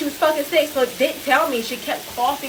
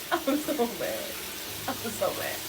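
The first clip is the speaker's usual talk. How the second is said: much softer, under the breath; quiet fuming; 10 dB quieter, slower than usual. Real, not acted. Venting to viewers, a soft fuming repeat. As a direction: say it again, almost to yourself.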